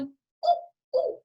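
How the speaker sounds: high squeaky monkey hoots; playful monkey ooh-ooh; monkey call. Shown as a demonstration. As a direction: hoot like a monkey. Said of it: "think about what sounds those animals make".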